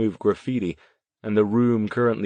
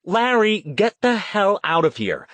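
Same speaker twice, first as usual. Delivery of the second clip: high, loud command; angry order; 12 semitones higher, 5 dB louder than usual. A narrator's reading. Petrucci ordering Larry out, angry order. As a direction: order him out sharply. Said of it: "Kelly Petrucci ordered".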